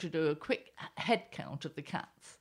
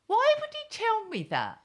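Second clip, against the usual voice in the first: high, loud, incredulous outburst; indignant why, pitched right up; outraged; 4 semitones higher, 4 dB louder than usual. Real, not acted. Columnist railing at her husband's confession, outraged.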